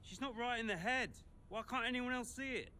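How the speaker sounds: high, loud frustrated plea; exasperated outburst. Acted. Arguing over a grieving colleague, exasperated. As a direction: burst out in exasperation.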